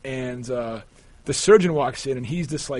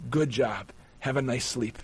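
low, soft South African imitation; lightly imitated South African surgeon, hushed at the end; South African accent; 3 semitones lower, 7 dB quieter than usual. Surgeon sending his patient to sleep, South African accent. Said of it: "in his accent".

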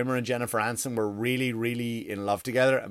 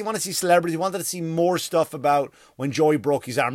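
ordinary talk, nothing marked

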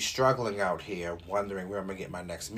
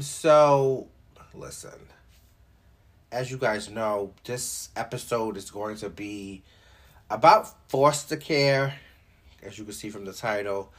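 usual speaking voice

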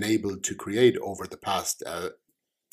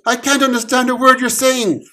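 higher, louder, fast panic; panicked protest; 8 dB louder, faster than usual. Acted. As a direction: blurt in a panic. Omen panicked.

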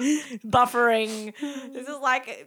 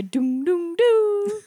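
mock chime; 8 semitones higher, 4 dB louder, much slower than usual. Imitating plane chime.

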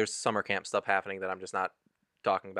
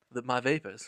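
Southern belle; 4 dB quieter than usual. Pretend.

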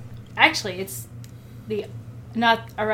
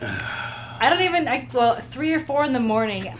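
ordinary delivery

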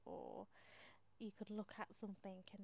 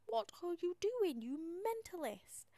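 bloke's look; 7 semitones higher, 7 dB louder, slower than usual. Retold or imitated. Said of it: "This bloke was looking at me to say".

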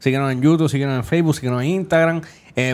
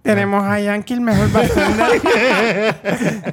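hammed-up intro; 6 semitones higher, much slower than usual. Hyping up the bartender.

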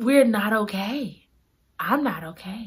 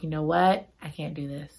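mild tearful quiet; 5 semitones lower, 6 dB quieter than usual. Retold or imitated. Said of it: "Chloe is crying at first".

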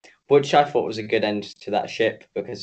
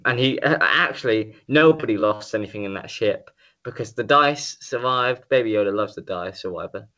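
normal talking voice

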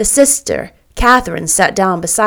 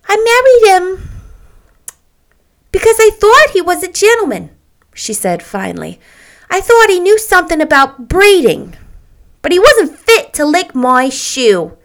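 high, loud, indignant wife; haughty, high-pitched scorn; Myrtle, disdainful; 11 semitones higher, 5 dB louder than usual. Acted. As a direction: look down on him as you explain.

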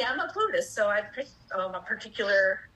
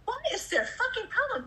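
angry inner voice; 6 semitones higher than usual. Retold or imitated. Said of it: "part of me is like".

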